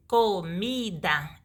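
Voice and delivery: "comida" slowly split into co, mi, da; syllable by syllable. Shown as a demonstration. Teacher giving a three-syllable example, syllable by syllable. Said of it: "A de três sílabas".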